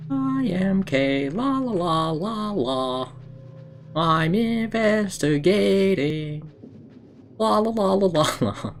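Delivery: lightly voiced high, slow singsong; a hint of a cutesy character humming along; silly character voice. Streamer voicing a playful investigator for chat, lightly singsong.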